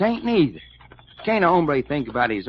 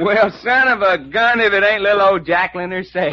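amazed exclamation; 7 semitones higher, 7 dB louder than usual. Acted. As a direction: crow at an unexpected face. Finds Jacqueline waiting in the garage.

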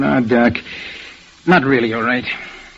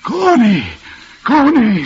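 calling out; 8 semitones higher, slower than usual. Acted. Reliving calling out in jungle.

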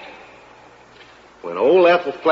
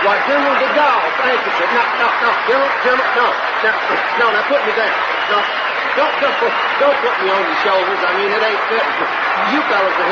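higher, flustered pleading; flustered protest; 4 semitones higher, faster than usual. Acted. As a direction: beg to be put down, half laughing. Lifted onto general's shoulders.

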